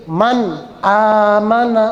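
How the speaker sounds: "man amana" with distinct n and long aa; drawn-out model, n unblended in MAN; clear izhar. Shown as a demonstration. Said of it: "dibaca jelas".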